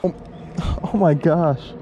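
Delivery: ordinary delivery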